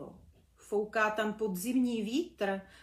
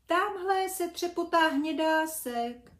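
higher, louder call; shouting bee; 7 semitones higher, 6 dB louder than usual. Acted. Brunda shouts to Čmelda, spotting a butterfly, higher and louder. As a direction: point it out across the sky. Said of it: "křičel Brunda".

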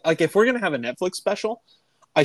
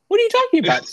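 put-on child voice; 4 dB louder, faster than usual. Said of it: "She's like".

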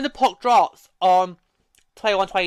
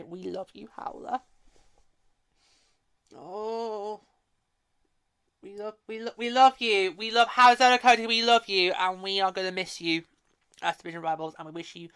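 tender farewell, trailing into softness; getting teary; 7 dB quieter than usual. Real, not acted. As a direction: say a fond goodbye, slightly choked up. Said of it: "Getting teary now".

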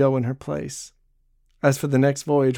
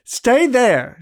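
much higher, louder order; sharp command; 9 semitones higher, 7 dB louder than usual. A narrator's reading. Barbe halts Jean stepping toward her, commanding. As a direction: stop him in his tracks. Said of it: "she commanded".

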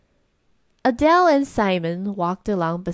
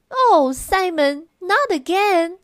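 high, loud, slow Oh Simon, exasperated; exasperated cry; 9 semitones higher, 5 dB louder, slower than usual. A narrator's reading. Big sister crying out at Simon's lost glove, exasperated. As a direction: groan it out at a hopeless little brother. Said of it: "阿黛拉叫起来".

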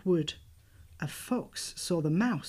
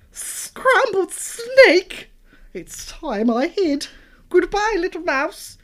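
alarmed snake voice; 11 semitones higher, 10 dB louder, slower than usual. Acted. Snake flees in fright.

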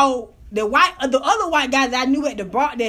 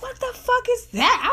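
high-pitched mock disbelief; a startled, high retold question; retold shock; 9 semitones higher than usual. Woman recalls seeing him exposed, high shocked disbelief.

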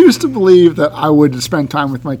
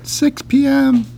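squeaky high-pitched imitation of his friend; mock him with a much higher voice; teasing falsetto impression of a buddy; 12 semitones higher than usual. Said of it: "I have a high voice like that".

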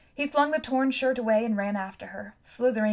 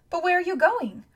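high, raised-voice question; urgent calling; 9 semitones higher, 6 dB louder than usual. A narrator's reading. Boy following girl who walks off, urgent. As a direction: call after someone walking away.

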